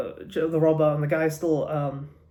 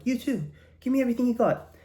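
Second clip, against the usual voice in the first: mock robber demand; 8 semitones higher, faster than usual. Robber turns to the guy in the bar, mock demanding. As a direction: act a robber ordering a victim, pitched up and quick.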